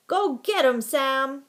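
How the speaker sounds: high, loud cheer; urging. A narrator's reading. Boy egging the dog on at the hole, urging shout. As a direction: urge the dog on at full voice. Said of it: "urged another boy".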